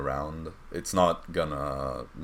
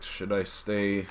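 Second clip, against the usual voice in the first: a hint of a character reluctant to get up, a little higher; lightly voiced reluctant sleeper; 4 semitones higher, 4 dB quieter than usual. Game master voicing Storm lying in bed, mildly reluctant. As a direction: lightly act out dithering in bed. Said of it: "lay there for a moment and you're like".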